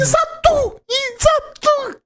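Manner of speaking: very high, hammed-up yell of agreement; excited shout. Real, not acted. Viewer cheering on the recap, excited high shout. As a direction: jump in with a squealing cheer.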